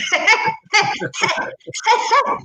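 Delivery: long, high cackling laugh; real laughter. Real, not acted. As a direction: crack up at your own joke. Laughing at own hungover joke.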